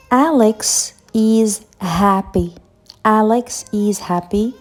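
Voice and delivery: Alex is happy said slowly, word by word; slow model sentence. Shown as a demonstration. Teacher models the sentence slowly for learners. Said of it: "Ou bien".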